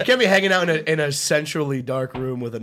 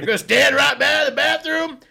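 high shouted jeer, slowed; loud heckle; 14 semitones higher, 9 dB louder, slower than usual. Retold or imitated. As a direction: call out the creep across the room. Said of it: "And Dave goes".

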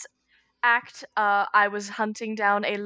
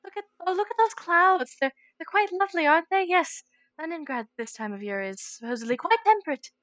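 high, airy polite chatter; prim small talk; 7 semitones higher than usual. Pretend. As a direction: chirp like a prim lady making small talk. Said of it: "so she'd be like".

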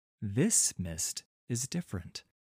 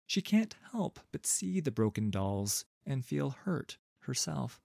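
plain reading voice

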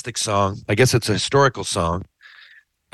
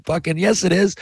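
excited yell; 10 semitones higher than usual. Retold or imitated. Friend's reaction to signed album.

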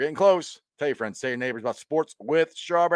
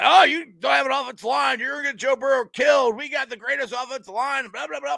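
mocking ranting fan; 11 semitones higher than usual. Cleveland fan attacking a rival podcaster on TikTok, high mocking rant. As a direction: imitate a worked-up heckler at full tilt. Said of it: "come after me so hard".

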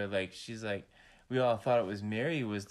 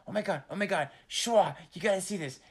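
lightly voiced, higher urgent impression; imitating excited partner; 6 semitones higher than usual. Man imitating partner waking him with news, mildly excited. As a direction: mimic her waking you with news, slightly heightened. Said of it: "and she's like".

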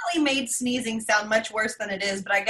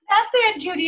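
excited; 10 semitones higher, 4 dB louder than usual. Retold or imitated. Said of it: "And I was like".

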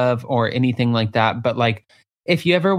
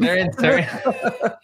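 laughing; 3 semitones higher, much slower than usual. Real, not acted. Laughing over funny word.

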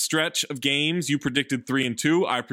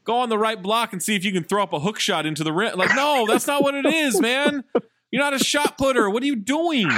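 exasperated mock scolding; 7 semitones higher than usual. Mocking the teammate's post-up.

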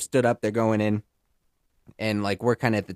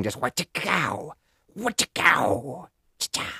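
soft, slow mock chop noise; quiet playful chopping effect; axe whack sound effect; 4 semitones higher, 4 dB quieter, slower than usual. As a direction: voice the thwack of the axe.

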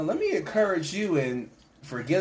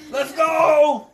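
high, loud cheer to the group; rallying shout; 11 semitones higher, 7 dB louder than usual. Acted. Early believers cheering each other on after a beating, rallying shout. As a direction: holler it to fire everyone up. Acted rallying cry continues.

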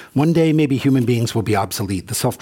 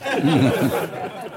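breathy real laugh, higher; short airy chuckle; 5 semitones higher than usual. Real, not acted. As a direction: chuckle at the passenger's exit. Laughs at his story.

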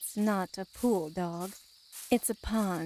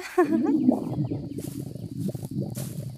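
sinister breathy chuckle; 6 semitones higher than usual. Acted. Sinister laugh after slipping "torment.

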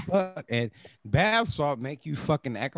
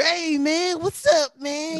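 high, overly friendly "Hey, man"; mock the thief's cheery hello; crackhead greeting; 12 semitones higher, 5 dB louder, slower than usual.

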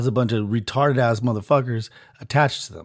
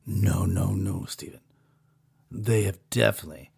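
hushed overlord; 7 semitones lower, 9 dB quieter than usual. Acted. Overlord answers again.

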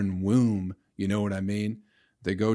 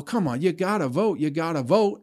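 mock pleading; 6 semitones higher than usual. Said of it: "And they'll go".

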